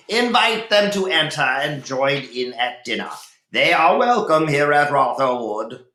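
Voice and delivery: lightly voiced lord aloud. A narrator's reading. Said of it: "aloud, he replied to his servant".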